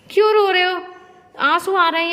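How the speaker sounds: high, very loud questioning; loud exclaiming. Real, not acted. Caregiver asking papa why he cries, loud high exclaiming. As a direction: cry out over the tears. Exclaiming over papa's tears.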